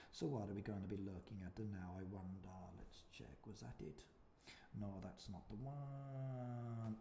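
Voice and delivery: quiet murmur while browsing; muttering to self. Real, not acted. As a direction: think aloud under the breath. Talking to self while searching.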